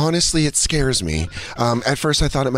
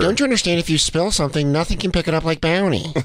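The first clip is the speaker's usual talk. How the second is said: ad read; 3 semitones higher than usual. Acted. Bounty ad parody.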